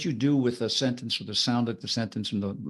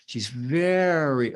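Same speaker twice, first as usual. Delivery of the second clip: VERY stretched long; dragged-out VERY; much slower than usual. Shown as a demonstration. Teacher demonstrating duration, VERY drawn out. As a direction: hold the vowel of "very". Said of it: "techniques that sometimes we call duration".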